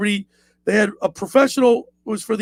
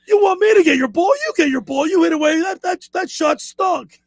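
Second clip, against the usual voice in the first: mock shouting; 7 semitones higher than usual. Caddy arguing with the golfer on the tee, shouting. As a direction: yell back at the golfer, mock furious. Retold argument on tee box.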